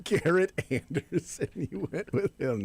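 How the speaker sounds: laughing mockery. Real, not acted. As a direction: repeat it slowly through laughter in disbelief. Laughing echo of co-host's weak pick.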